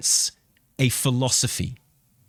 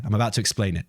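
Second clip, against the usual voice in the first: usual speaking voice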